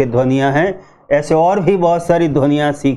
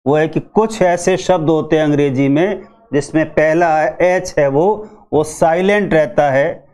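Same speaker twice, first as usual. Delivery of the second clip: ordinary delivery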